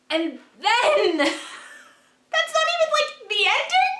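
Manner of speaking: high, giddy laugh through words. Real, not acted. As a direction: laugh in disbelief. Laughing at more plot twists.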